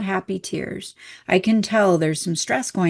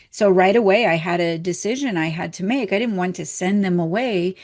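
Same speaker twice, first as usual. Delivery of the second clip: usual speaking voice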